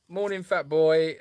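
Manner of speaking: drawn-out loving hello to a fat pig; affectionate greeting. Retold or imitated. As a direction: greet the pig you adore.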